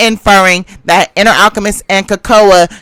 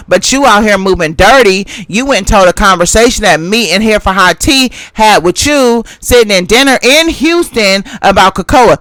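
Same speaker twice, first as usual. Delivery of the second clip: ordinary talk, nothing marked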